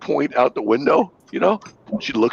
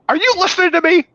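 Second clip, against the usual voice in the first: imitating wife. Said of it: "she goes".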